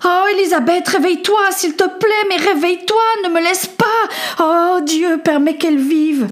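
high, frightened begging; desperate pleading. Acted. Boy begs his unconscious friend to wake, high and desperate.

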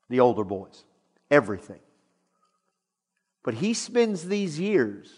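ordinary talk, nothing marked

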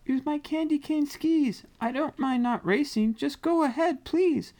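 very high, piping penguin voice; piped up. A narrator's reading. Penguin offers his skis to Squee, high piping voice. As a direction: pipe up in a squeaky little voice. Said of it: "Perry piped up".

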